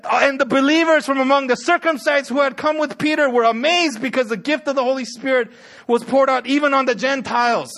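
scripture read out loud, high and forceful; impassioned pulpit reading; preach. Acted. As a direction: proclaim the climax with rising fervor.

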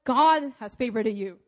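"God" hit loud and held, the rest falling away; stress on GOD. Shown as a demonstration. Preacher showing what emphasis reveals, stressing "God". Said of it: "you emphasize different words in a sentence".

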